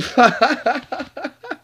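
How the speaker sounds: high burst of laughter; high-pitched giggling fit. Real, not acted. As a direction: crack up in a long run of ha-has. Cracks up after the song.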